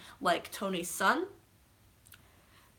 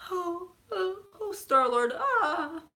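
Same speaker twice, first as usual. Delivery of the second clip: high mock whimpers; demonstrated crying; 10 semitones higher, slower than usual. Shown as a demonstration. Showing her crying level for Star-Lord, whimpering. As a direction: whimper and moan through the name. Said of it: "my crying level. I'll show you".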